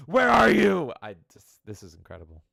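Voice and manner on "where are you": sudden loud call. Pretend. Playful taunt in the voice.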